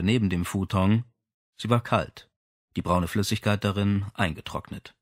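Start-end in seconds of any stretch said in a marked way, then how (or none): none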